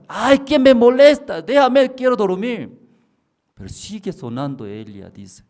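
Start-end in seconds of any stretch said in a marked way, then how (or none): 0.1-2.8 high, loud whiny complaint; woman woken at night, high and loud; annoyed sleeper. Retold or imitated. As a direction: push the voice away, half asleep.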